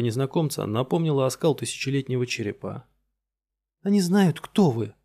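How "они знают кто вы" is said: hissing. Acted. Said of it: "прошипела она".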